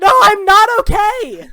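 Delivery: high, furious yell; distraught angry shout; shouting in someone's face. Acted. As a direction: blast it at full volume. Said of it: "shouting directly into your face".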